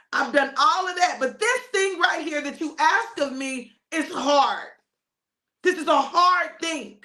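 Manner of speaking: high, strained appeal to God; anguished protest. Acted. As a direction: plead that the burden is too much. Jesus tells God it is hard.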